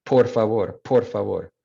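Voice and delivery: gringo-accented por favor, aspirated P; Spanish with an American accent and airy p. Shown as a demonstration. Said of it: "kind of like that gringo accent".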